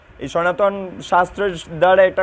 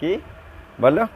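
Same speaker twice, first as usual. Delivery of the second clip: normal talking voice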